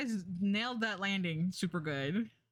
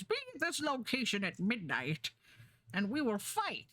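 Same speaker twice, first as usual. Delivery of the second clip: theatrical villain challenge, a touch higher; 4 semitones higher than usual. Retold or imitated. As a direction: growl out a cartoon villain's threat. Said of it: "this is my go-to villain voice".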